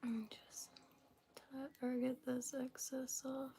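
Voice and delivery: whispering while holding breath; breath held, words barely voiced. Real, not acted. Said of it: "Just holding my breath a little bit".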